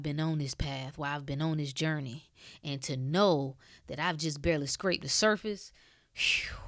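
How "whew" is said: emotional exhale. Real, not acted. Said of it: "I, I get emotional".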